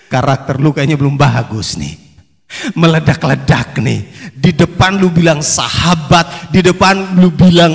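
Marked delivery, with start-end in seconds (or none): none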